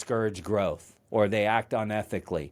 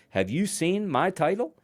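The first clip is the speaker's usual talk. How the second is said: higher-pitched, rising rhetorical questions; arrogant indignation; 7 semitones higher than usual. Retold or imitated. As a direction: imitate an affronted boss pulling rank. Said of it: "an arrogant mentor".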